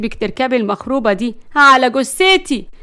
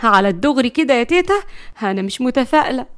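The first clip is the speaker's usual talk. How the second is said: ordinary delivery, nothing marked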